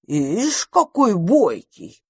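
drawn-out amused approval; approving. A narrator's reading. Magpie admires bold little dragon, drawn-out approval. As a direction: admire the cheeky youngster, stretching the words. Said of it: "одобрила сорока".

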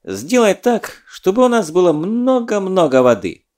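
eager wish. Acted. Zadov wishes to genie.

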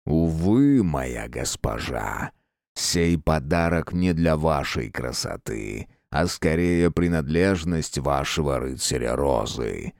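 low, slow, deep demonic voice; slow menacing villain rumble; dark lord. Acted.

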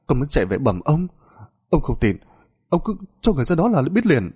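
high, fast, desperate insisting; frantic pleading. A narrator's reading. Servant boy insisting to the master, frantic pleading. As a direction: beg him to believe you.

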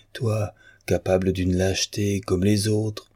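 ordinary delivery

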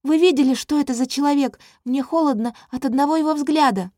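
high, loud upset princess; shuddering at the thought; distressed. A narrator's reading. Princess frightened of king.

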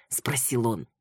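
usual speaking voice

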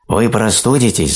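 high-pitched storybook shout; hedgehog's warning cry. A narrator's reading. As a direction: call a warning as a little hedgehog. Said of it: "закричал ёжик".

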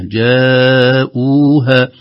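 "جاءوها" with a very long held vowel; connected madd. Shown as a demonstration. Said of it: "مد متصل". (مد متصل في "جاءوها")

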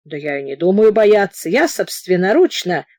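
plain reading voice

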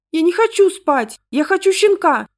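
put-on high, loud little-girl tantrum; hysterical child's stage whisper. A narrator's reading. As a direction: insist frantically in a high, strained voice. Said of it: "жутким истеричным шёпотом".

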